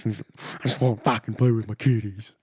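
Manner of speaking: lightly Canadian Bubbles voice; Bubbles impression. Doing Bubbles for a fellow Trailer Park Boys fan. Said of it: "He goes like this".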